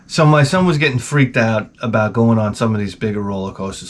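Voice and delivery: ordinary talk, nothing marked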